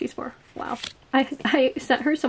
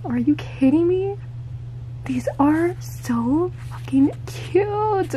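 slow, hushed, high whisper of awe; drawn-out adoring whisper; slow gushing whisper; 5 semitones higher, slower than usual. Real, not acted. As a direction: whisper it, overwhelmed by cuteness. Overwhelmed by cute stickers.

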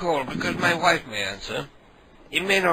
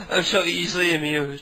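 ordinary delivery

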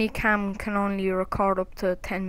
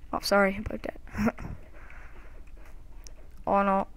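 ordinary talk, nothing marked

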